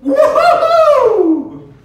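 very high, very loud cheer; joyful whoop. Retold or imitated. The women running from the tomb, shrieking for joy. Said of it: "these women are going".

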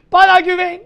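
loud, high pep-talk shout; hyped shout. Acted. Teacher yelling encouragement at a tired student. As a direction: fire the student up.

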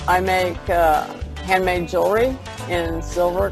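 usual speaking voice

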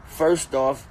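ordinary talk

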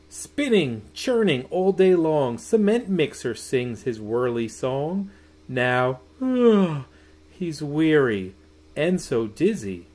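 put-on lilting storybook voice for children; sing-song bedtime reading; reading a bedtime story. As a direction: read it like tucking kids in. Reads bedtime picture book aloud.

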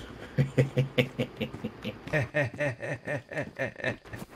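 long, high fit of giggles. Real, not acted. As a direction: giggle helplessly, on and on. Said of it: "I got the giggles".

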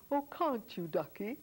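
a light, kindly voicing of a tall London bobby; lightly voiced kind policeman. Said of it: "looked down at me very kindly, and he said".

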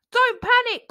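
high, loud call. A narrator's reading. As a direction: call up from below. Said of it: "called a voice from below".

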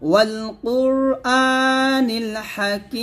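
melodic tajweed recitation; Quran recitation. Shown as a demonstration. Teacher reciting the verse for students to follow. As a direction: chant it to the students.

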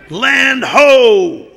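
high, loud shout from above; lookout's yell. Acted. Lookout in the mast yelling land to the crew, high and loud. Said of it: "the guy in the mast yells".

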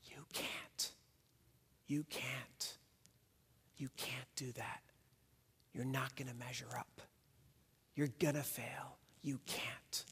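soft menacing whisper; Satan's whisper. Acted. Satan whispering lies into the listener's heart, hushed and menacing. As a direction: breathe the lies like a tempter. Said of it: "Satan whispers these two destructive words".

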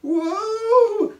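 very high, loud squeal; long alarmed "whoa". A narrator's reading. Craig as the bottle slides down rocks, squealing. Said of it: "squealed Craig".

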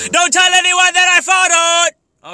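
slow, very loud high scream; mock secret yelled. Vlogger begging secrecy about a fart, screaming it. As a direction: comically bellow the secret at high pitch. Yells the secret after whispering.

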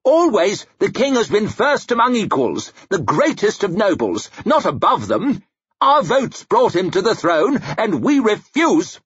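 high, emphatic noble's objection; outraged lord speechifying; indignant protest. A narrator's reading. Angry lord protesting to the council.

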